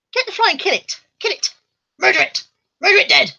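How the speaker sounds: high, urgent commands; high-voiced mock bloodthirsty orders; egging on. Real, not acted. Egging the dog on to kill.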